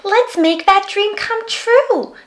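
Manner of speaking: put-on high, bright-eyed child asking; a curious small girl checks on a sad creature; little girl voice. A narrator's reading. Little girl asks the Yeti what's wrong, high and curious.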